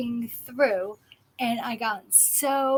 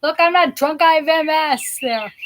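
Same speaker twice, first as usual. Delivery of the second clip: louder and higher, tipsy insistence; mock drunken protest; 5 semitones higher, 9 dB louder than usual. Tipsy speaker loudly protesting to disgusted onlookers at a bar. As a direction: loudly insist you're sober. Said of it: "I'm telling everybody".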